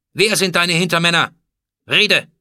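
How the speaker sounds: loud, high commanding demand; imperious interrogation. A narrator's reading. Pharaoh demands the names of accomplices, angry command. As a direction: order him to talk, full force. Pharaoh orders traitor to talk.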